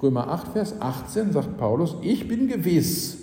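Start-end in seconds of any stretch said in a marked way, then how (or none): 2.0-3.2 high, slow, assured declaration; raised pitch, each word firmly placed; certain. Retold or imitated. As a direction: quote it slowly, with full conviction. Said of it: "also Paulus sagt nicht, Ich vermute das".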